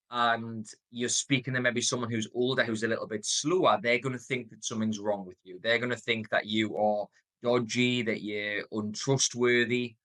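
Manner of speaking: ordinary delivery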